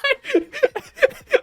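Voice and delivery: squealing burst of laughter; high-pitched laugh. Real, not acted. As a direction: shriek of laughter. Laughs at own story.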